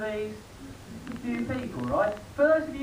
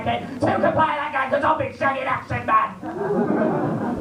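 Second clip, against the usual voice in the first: nagging wife yelling; 3 semitones higher than usual. Acted. Fifty-two-year-old Barbie berates Ken, shouting. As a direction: rant at Ken like a fed-up wife. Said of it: "I think it would go like this".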